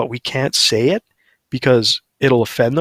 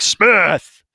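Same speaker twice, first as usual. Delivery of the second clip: retold yell; 7 semitones higher, 5 dB louder than usual. Cutting off hairdresser, yelling name.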